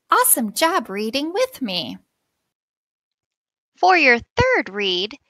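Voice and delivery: usual speaking voice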